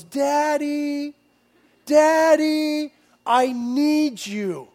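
put-on high, child-like call of Daddy, drawn out; mock child shout from the bedroom; imitating a son calling. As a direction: call like a small boy from his bed. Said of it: "he yells".

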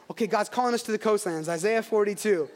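usual speaking voice

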